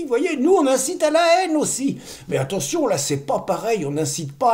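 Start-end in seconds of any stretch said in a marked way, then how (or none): 0.0-2.0 sarcastic. Acted. Sarcastic aside about themselves.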